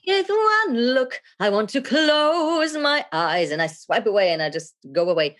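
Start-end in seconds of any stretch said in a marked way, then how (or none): 0.1-3.5 high, drawn-out groan of boredom; bored viewer. Acted. Viewer put off by dull video, hammed-up boredom. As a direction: stretch the vowels slowly, held high in mock tedium.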